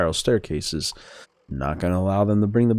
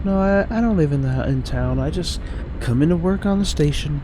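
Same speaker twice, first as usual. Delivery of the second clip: higher-pitched game character voice; Emily; 9 semitones higher than usual. Acted. Station worker greeting an early visitor, lightly feminine voice. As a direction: lift the voice for a helpful station worker.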